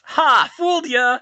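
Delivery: high, loud mocking laugh-shout; gleeful gotcha. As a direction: tease the listener in triumph. Joking disclaimer, mock gotcha.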